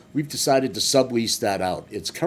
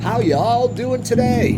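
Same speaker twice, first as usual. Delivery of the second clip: higher, louder, slow southern drawl; southern accent; 12 semitones higher, 7 dB louder, slower than usual. Shown as a demonstration. Greeting his viewers in a southern accent. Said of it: "it's a southern accent".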